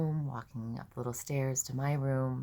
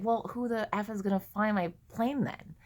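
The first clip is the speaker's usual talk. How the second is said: high, louder, mock-exasperated question; exasperated retort; 7 semitones higher, 5 dB louder than usual. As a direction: demand it in acted frustration. Acting out exasperation at therapist.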